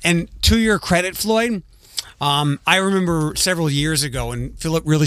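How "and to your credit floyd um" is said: ordinary talk, nothing marked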